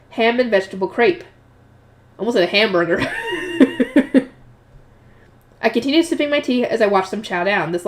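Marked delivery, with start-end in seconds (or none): 3.0-4.2 high laugh at own slip; real laugh. Real, not acted. Reader amused by nearly saying hamburger, laughing. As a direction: laugh at your own mistake.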